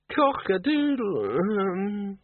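high, very slow, broken-off cock-a-doodle; strangled rooster crow. Acted. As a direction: crow like a rooster that can't finish. Cockerel crowing again, higher.

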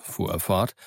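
plain reading voice

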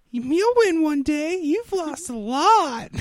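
freaky Mickey Mouse voice; very high, slow, loud squeaky mock pep talk; Bo Dallas. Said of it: "You sound like a freaky Mickey Mouse".

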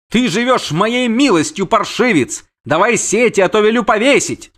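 high, loud threatening prince; furious prince. Acted. Prince threatens to hang the captain, furious.

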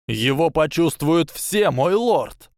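high, rising frightened cry; panicked warning. A narrator's reading. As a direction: warn him in growing panic. Quirrell panics, warns master.

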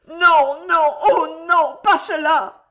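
loud, high, tearful wail; heart-rending cry. A narrator's reading. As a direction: cry out in anguish, drawing out each no. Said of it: "haleta Jeanne dans un cri déchirant".

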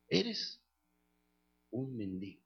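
pause, then let the insult fall softly; padre despectivo. Retold or imitated. Padre repite su sentencia. (sentencia de padre, pausada y en voz baja)

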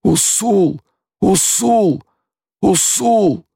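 high repeated cries of grief; anguished moan. A narrator's reading. Stilgar grieving over his blinded friend, moaning. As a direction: wail the name high, each time apart. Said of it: "простонал Стилгар".

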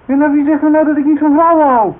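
defensive outrage at her hint; indignant shout. Acted. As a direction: shout down the accusation. Accused of not liking women.

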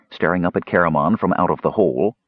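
plain reading voice

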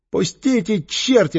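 high, wailing plea; tearful cry. A narrator's reading. Captured boy to those dragging him, tearful cry. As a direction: wail it, pleading to be freed. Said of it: "раздался чей-то плачущий голос".

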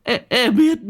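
trembling thug stammers his promise to Nam; terrified stammer. A narrator's reading. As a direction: stutter out in terror. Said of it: "run cầm cập lắp bắp".

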